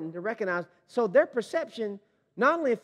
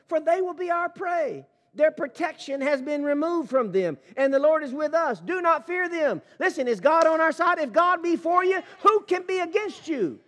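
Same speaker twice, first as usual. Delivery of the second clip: fervent preaching; 7 semitones higher than usual. Acted. Preacher rallying the congregation against fear, fervent and high. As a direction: build the crowd to a triumphant peak.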